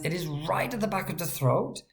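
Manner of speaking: usual speaking voice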